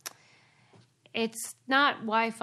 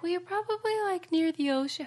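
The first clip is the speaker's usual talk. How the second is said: high-pitched mimicry of a phone rep; mimicked phone rep; 9 semitones higher than usual. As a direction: parrot their excuse in a much higher voice. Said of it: "they're always like".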